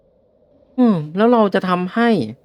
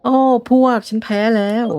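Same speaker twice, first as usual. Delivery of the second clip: defeated rival; 6 semitones higher than usual. Acted. Rival concedes the battle.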